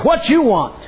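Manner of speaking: high, curt and wary with a laugh; suspicious challenge. Retold or imitated. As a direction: brush off a stranger with a laugh. Woman turns on the stranger.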